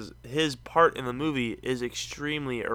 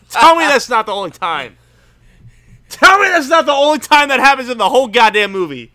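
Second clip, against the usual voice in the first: much higher, louder incredulous shouting; exasperated rant; 9 semitones higher, 11 dB louder than usual. Real, not acted. Ranting to his friend about the movie's dumb shooting scenes, loud. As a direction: demand it, disbelieving and loud.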